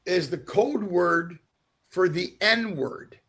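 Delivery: slow, spaced-out retold explanation; deliberate reveal. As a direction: spell it out slowly, with pauses between phrases. Delivering the reveal of the story.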